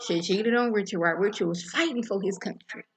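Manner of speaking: ordinary talk, nothing marked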